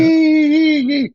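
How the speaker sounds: high squeaky yee yee; squeaky mock cheer. Mimicking the gummy bears and unicorns partner, high squeal. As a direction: squeal it like a giddy cartoon. Mocking the gummy-bear type.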